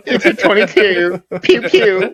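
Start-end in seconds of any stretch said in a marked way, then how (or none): 1.4-2.1 pew pew gun sounds. Pretend. Imitating small gun shots.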